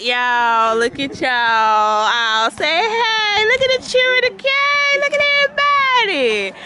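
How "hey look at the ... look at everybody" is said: high, sing-out crowd greeting; excited greeting. Real, not acted. Vlogger showing the crowd to camera, excited high call. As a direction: call out to everyone, pitched way up.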